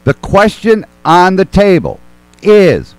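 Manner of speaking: slow, spaced-out questioning with long pauses; slow deliberate question. Real, not acted. Host slowly putting the question to his guests, deliberate and spaced. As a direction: lay out each word slowly, making them listen.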